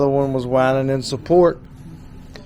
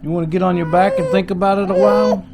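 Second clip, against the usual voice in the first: put-on higher, sing-song coaxing to a baby; baby talk. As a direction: coo encouragement at the little one. Parent cooing to crawling baby.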